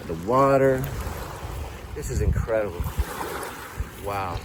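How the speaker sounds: softly awed, pitched low; low, understated marvelling; quiet wonder. Acted. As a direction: take it all in with hushed amazement. Taking in the view in wonder.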